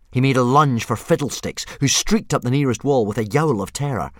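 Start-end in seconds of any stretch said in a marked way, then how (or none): none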